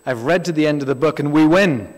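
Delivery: mock-preach the line with triumphant punch; imitating a loud preacher. Quoting an American preacher to the congregation, loud triumphant proclaim. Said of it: "I heard an American preacher years ago say".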